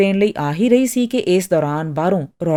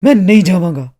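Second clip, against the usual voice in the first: louder, angrier refusal with a hard-hit first word; angry insistence; 8 dB louder than usual. A narrator's reading. Artist refusing to be dragged out, louder and angrier. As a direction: dig in with a loud, furious protest. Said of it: "ਉਹ ਗੁੱਸੇ ਨਾਲ ਬੋਲਿਆ".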